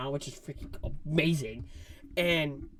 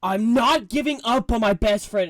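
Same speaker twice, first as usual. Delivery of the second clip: loud, higher vow; Star-Lord determined; 8 semitones higher, 14 dB louder than usual. Retold or imitated. Star-Lord refusing to give up on Rocket, loud. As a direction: swear it fiercely. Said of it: "Star-Lord's like".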